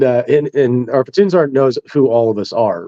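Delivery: normal talking voice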